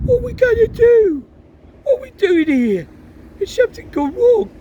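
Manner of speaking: confused and a bit panicky; bewildered boy. Acted. Boy squashed, confused in fireplace.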